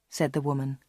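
ordinary delivery, nothing marked

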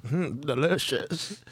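slow, lingering mm of pleasure; mock connoisseur, slow and savoring. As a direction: savor it like a mock wine snob. Savoring the wine pairing.

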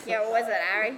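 loud put-on film-line voice; Harry Potter quote. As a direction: echo the famous line in character. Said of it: "quote a Harry Potter line".

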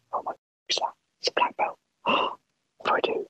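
hushed, frightened whisper; awed whisper. Retold or imitated. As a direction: gasp then whisper in awe. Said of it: "be like".

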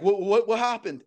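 stammered, innocent puzzlement, acted; imitated naive friend. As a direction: mimic someone oblivious to the disaster. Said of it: "He's just, like, naive".

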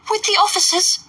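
put-on high, eager girl's call; high excited young girl; giddy Lydia. A narrator's reading. Lydia cries out.

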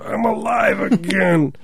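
put-on high, slow, shouting old lady; zombie grandma. Host voices grandma back from the grave, high slow shout. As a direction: cry out like a granny just risen from the grave. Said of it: "grandma comes up from the grave".

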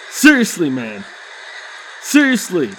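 ordinary delivery

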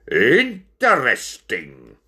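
slow, drawn-out appraisal; intrigued. Acted. Ringmaster eyeing Binnicky up and down, slowly intrigued. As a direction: stretch each syllable as you size him up.

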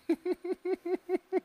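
squeaky burst of laughter; high-pitched laugh. Real, not acted. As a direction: break into a high giggle. Laughs at own joke.

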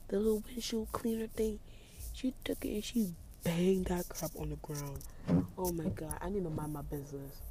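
hushed gossip. Real, not acted. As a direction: talk under the breath so she won't hear. Narrating scene outside quietly.

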